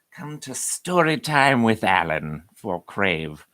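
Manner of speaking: slow, drawn-out storybook host voice; a touch hammed-up story time announcer; storyteller voice. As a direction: welcome them like a kids' TV storyteller, slowly. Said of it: "I don't know why that voice just took over me".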